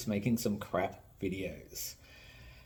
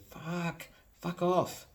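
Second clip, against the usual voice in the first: high, soft exasperated dismissal; 7 semitones higher, 4 dB quieter, much slower than usual. Acted. Reacting to a toy nerd's terrible things, high soft exasperation. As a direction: curse under strain, pitched up and quiet.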